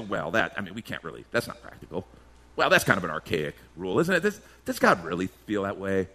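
a mock skeptic hedging against the rule; skeptical dismissal. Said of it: "Do I sit and go".

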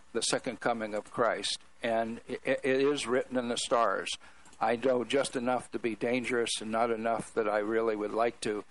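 usual speaking voice

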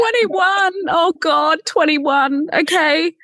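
high-pitched, exclaiming twenty-one in mock horror; shocked disbelief. Real, not acted. Host reacting to a guest being only twenty-one, shrieking in mock shock.

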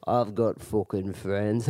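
low, slow imitated Irishman; Irish accent. Irishman answering Zach's challenge, low Irish accent. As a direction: drawl it defensively in low Irish. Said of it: "And then he goes".

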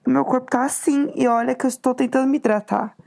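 normal talking voice

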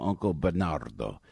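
ordinary talk

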